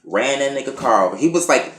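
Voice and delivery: ordinary talk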